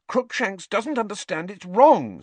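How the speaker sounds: high, upset protest; voice shaking. A narrator's reading. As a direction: plead with an unsteady voice. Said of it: "her voice shaking".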